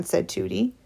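plain reading voice

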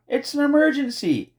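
high urgent shout; shouted alarm. A narrator's reading. Girl alerting the neighbor, urgent shout. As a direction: raise the alarm, pitched right up. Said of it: "shouted Flora".

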